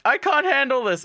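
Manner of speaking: high, loud, panicked squeal; a girl fleeing in a high loud panic. Retold or imitated. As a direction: shriek it out like she's overwhelmed. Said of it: "redhead girl's like".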